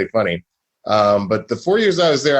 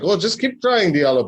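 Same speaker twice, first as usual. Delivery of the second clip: higher-pitched attempt at a German accent; 7 semitones higher than usual. German professor encouraging his struggling econometrics student, in a German accent. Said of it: "that was my best German accent".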